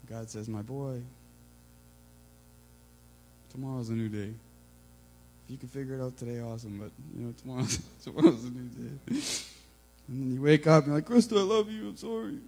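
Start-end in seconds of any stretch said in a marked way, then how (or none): 0.5-9.0 calm a worried son; gentle God. Retold or imitated. Voicing God giving peace. 11.1-12.4 much higher apologetic voice; high sheepish sorry voice to wife; sheepish apology. Retold or imitated.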